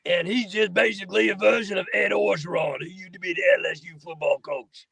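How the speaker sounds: Louisiana football coach impression; Ed Orgeron. Shown as a demonstration. Actor demonstrates his animatronic rat, bayou coach voice. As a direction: put on the bayou coach's voice throughout. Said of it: "And he talks like this".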